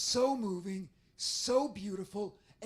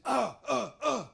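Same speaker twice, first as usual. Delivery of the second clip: put-on louder, creaky old man stammer; Grandpa Simpson; 8 dB louder than usual. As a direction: stammer like a doddering cartoon grandpa. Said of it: "even when it is all Grandpa Simpson".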